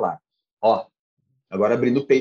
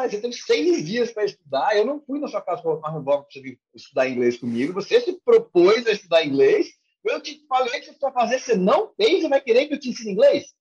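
higher-pitched, indignant mock telling-off; scolding teacher; 5 semitones higher than usual. Teacher to a student who claims no time to study, scolding. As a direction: act out a teacher dressing down a student. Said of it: "você vai tomar a chamada do professor".

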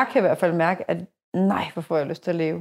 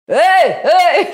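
loud calling out hey; shouting from a passing car; retold shout; 8 semitones higher, 8 dB louder than usual. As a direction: hail her loudly from the car. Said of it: "Så råber de bare sådan".

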